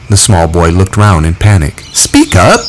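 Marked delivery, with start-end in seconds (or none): 1.9-2.6 high, pressing call to speak up; a boy calling out to a frightened child; urging. A narrator's reading. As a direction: push the shy boy to talk. Urging scared boy before assembly.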